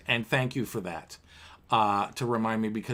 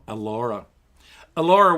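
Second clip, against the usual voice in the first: usual speaking voice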